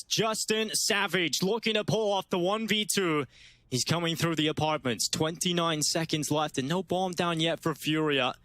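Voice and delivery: ordinary talk, nothing marked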